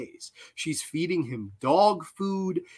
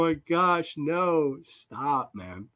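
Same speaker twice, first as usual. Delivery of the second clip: ordinary delivery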